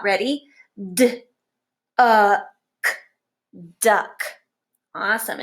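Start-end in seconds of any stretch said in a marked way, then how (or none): 0.9-2.9 D, uh, k as separate sounds with gaps; sounded out. Shown as a demonstration. Teacher sounding words out with the class, sounded out. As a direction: say D, then a firm uh, then a hard k. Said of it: "Let's sound these out".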